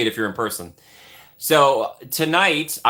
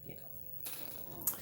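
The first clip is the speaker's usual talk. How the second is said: whispered name; 29 dB quieter than usual. Pretend.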